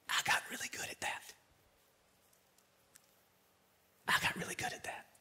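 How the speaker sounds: whispered confession. Real, not acted. Preacher confessing his own mask to the church, whispered. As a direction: breathe it out as a private admission.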